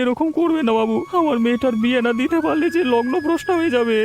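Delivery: high pleading cry to the master; desperate begging. Acted. Poor father begs the headman, desperate and high. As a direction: beg him not to take it.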